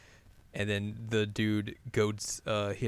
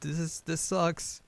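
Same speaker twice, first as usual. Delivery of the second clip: higher-pitched mock dejection; bummed out, imitated; 7 semitones higher, slower than usual. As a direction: whine it a little, playing let down. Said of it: "he's real bummed about that".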